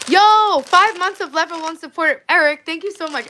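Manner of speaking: high, loud hype shoutout; big streamer hype for a new member; excited shout. Real, not acted. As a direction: shout the thanks out with joy.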